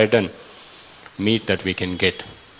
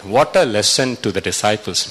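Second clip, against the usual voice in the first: usual speaking voice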